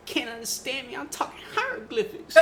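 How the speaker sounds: high, laughing rapper voice; [name] impression. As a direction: pitch way up and giggle through it. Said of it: "my [name] impression".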